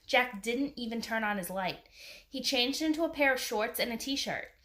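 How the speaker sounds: plain reading voice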